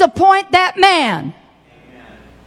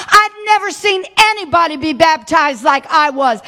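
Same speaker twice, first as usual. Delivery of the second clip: normal talking voice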